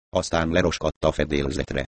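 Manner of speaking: usual speaking voice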